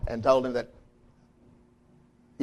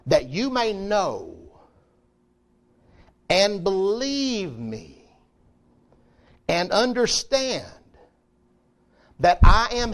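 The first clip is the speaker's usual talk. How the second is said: slow, rising higher and louder; building scripture reading; 7 semitones higher, 4 dB louder, slower than usual. Acted. Preacher reads Yahweh's words to the congregation, building slowly. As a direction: climb the verse word by word toward "that I am he".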